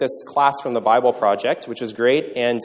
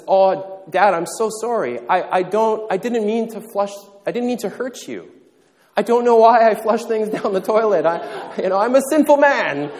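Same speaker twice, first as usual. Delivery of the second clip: put-on higher, pleading sorry-voice of a sobbing kid; mock tearful apology; 7 semitones higher, 4 dB louder than usual. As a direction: beg forgiveness like a weepy child. Crying child apologizes.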